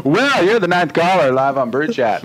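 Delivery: loud, high mock DJ announcement; over-the-top shouted on-air welcome; yelled radio greeting. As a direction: yell it like a radio host to a winning caller. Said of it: "when you yell like that".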